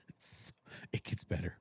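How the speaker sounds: teasing whisper to friends; whispered tease about the plot. Real, not acted. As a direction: hush it like a secret.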